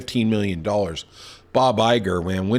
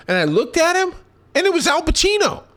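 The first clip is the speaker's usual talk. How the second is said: much higher, starstruck telling; excited reveal. Retold or imitated. Telling listeners he really saw Al Pacino, excited and high. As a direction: let it rise with amazement.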